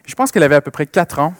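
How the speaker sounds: ordinary talk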